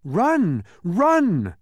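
high, loud, drawn-out shout; urgent cry. A narrator's reading. A voice warning them away from the Trivium, urgent cry. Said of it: "a voice cried out".

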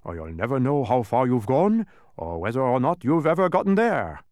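scold, building in force; emphatic telling-off in character. A narrator's reading. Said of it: "scolded the dodecahedron".

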